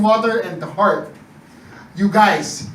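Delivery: usual speaking voice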